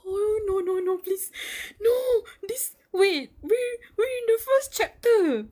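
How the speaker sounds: high, loud panicked pleading. Real, not acted. Streamer panicking over the chapter to the viewers, loud high pleading. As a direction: beg the chapter not to go there.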